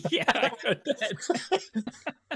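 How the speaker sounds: high, drawn-out helpless laughter through the words; laughing. Real, not acted. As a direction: crack up while agreeing. Cracking up at age comparison.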